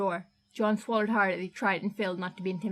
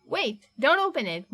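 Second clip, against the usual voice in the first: urgent cry, louder, slow and drawn; panicked; 5 semitones higher, 5 dB louder than usual. Acted. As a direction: stop him in a panic. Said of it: "Martin panicked".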